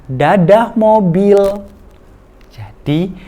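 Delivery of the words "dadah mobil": high, loud, slow bye-bye to the car; sing-song goodbye to toy. Shown as a demonstration. Parent coaxing child to leave toy, high slow sing-song. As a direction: wave the toy car goodbye in baby talk. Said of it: "Habis itu kita katakan".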